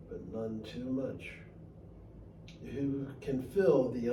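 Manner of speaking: slow, soft, measured reading of a poem; poem recital. Acted. Reading his favourite poem aloud for a podcast, slow soft recital. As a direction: let each line land, unhurried and quiet.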